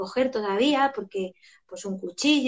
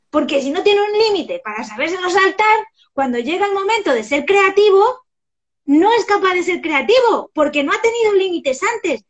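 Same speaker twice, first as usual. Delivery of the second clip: emphatic; 6 semitones higher, 7 dB louder than usual. Real, not acted. Preaching point.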